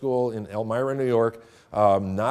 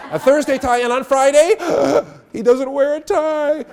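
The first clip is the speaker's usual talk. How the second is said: put-on excited kid; 14 semitones higher, 11 dB louder than usual. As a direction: voice jumps much higher and louder, slowing on the punchline. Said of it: "she got really excited".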